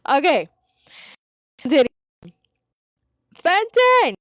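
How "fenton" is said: sudden shout, much higher and louder; real yell. Real, not acted. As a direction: call the name out loud across the room. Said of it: "Sorry, sorry to yell".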